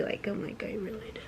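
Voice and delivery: soft whispered aside. Real, not acted. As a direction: whisper it to viewers.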